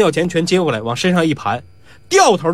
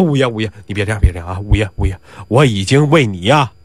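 placating; 9 semitones lower than usual. A narrator's reading. Placating the groaning man.

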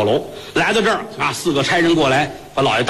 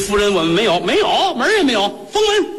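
rising frantic protest, faster at the end; panicked denial; 5 semitones higher than usual. Acted. Shopkeeper frantically denying to the wife, panicked rush.

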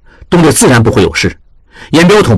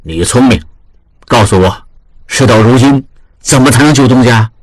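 lower, steady voice of a senior manager giving orders; lower, serious old boss; grave elder master; 6 semitones lower than usual. A narrator's reading. Master weighs the rescue.

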